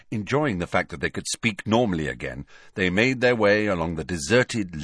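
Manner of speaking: plain reading voice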